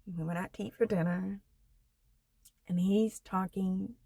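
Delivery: ordinary talk, nothing marked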